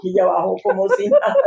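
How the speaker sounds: real laugh breaking through the words; laughing while telling. Real, not acted. Telling her friend about the pastor, laughing. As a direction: let the laugh take over the end.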